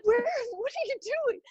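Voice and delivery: high, startled question; bewildered at all the open doors; mock surprise. Surprised by nuns popping out.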